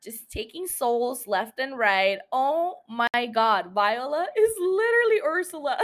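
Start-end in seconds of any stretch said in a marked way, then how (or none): 3.7-5.8 laughing while she says it; half laughing, half crying with amusement. Real, not acted. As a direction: crack up mid-sentence. Laughing at her own comparison.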